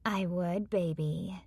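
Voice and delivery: soft flirty coo. Acted. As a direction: coo it to him softly. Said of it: "she cooed".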